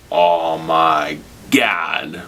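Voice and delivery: very slow, stretched-out amazement; drawn-out stunned viewer reaction; acted awe. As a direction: draw each word out in mock awe. Acting awed viewer at monster reveal.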